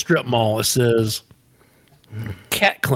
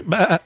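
imitated bleat, higher pitched; goat bleat; 6 semitones higher than usual. Goat interrupting the prank message, bleating. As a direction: cut in with a goat noise.

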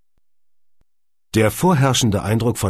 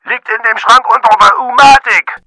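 very loud, high yell; bellowing; 14 dB louder than usual. A narrator's reading. Matthias yelling through the headphones, bellowing. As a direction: roar it full force down the headphones. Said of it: "grüllte Matthias durch den Kopfhörer so laut".

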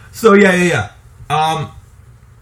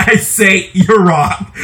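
usual speaking voice

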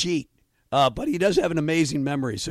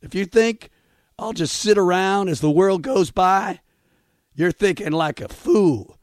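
reading lyrics in a country drawl; country twang, recited slow; country accent; 3 dB louder, slower than usual. Pretend. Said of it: "Here we go, in a country accent".

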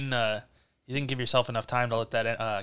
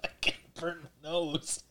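coughing from spice; 6 semitones higher, 6 dB louder, much slower than usual. Real, not acted. S0 reacting to spicy drink.

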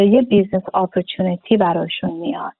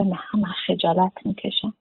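mock shy reply; 8 dB quieter than usual. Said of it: "مثلاً میگه".

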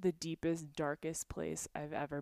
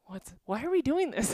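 exasperated; 7 semitones higher, 8 dB louder than usual. Retold or imitated. Retold, then laughing.

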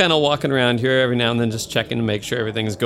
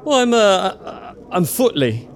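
nervous guest; 7 semitones higher, slower than usual. Acted. GM voices nervous guest Footley.

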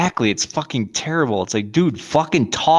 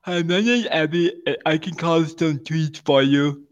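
mock Abby; 3 semitones higher than usual. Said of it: "Abby, yes".

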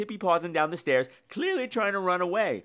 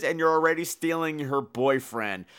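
usual speaking voice

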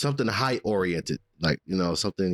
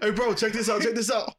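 much higher cocky bro voice; frat bro. Acted. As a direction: hype it up like a reckless bro. Friend showing off on glass.

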